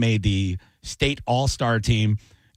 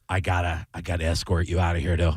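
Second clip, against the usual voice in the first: low dude voice; 7 semitones lower than usual. Retold or imitated. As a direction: lower laid-back sheriff voice. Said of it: "he goes".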